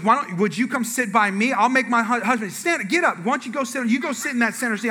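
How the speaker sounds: acted insistent instructions; bossy wife. Wife organising the swap, acted.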